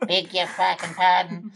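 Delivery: mock polite. Cabbie repeats how the passenger said it, mock polite. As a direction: act politely offended with a swear dropped in. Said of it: "it was polite, like".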